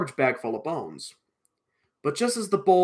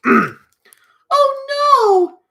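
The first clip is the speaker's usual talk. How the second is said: high, very loud "oh no" wail; groaning wail; 10 dB louder than usual. Acted. Betty plunged into darkness, loud high groaning wail. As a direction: wail it out in high-pitched dismay. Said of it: "groaned Betty".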